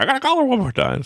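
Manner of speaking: very high, rushed mock desperation; hammed-up frantic begging; frantic pleading. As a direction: blurt it fast in a squeaky, desperate voice. Pleading with annoyed staff for call.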